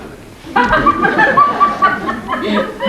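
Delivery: a high, hooting burst of laughter; big laugh. Acted. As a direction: crow with laughter. Sir Anthony laughs at mad girl.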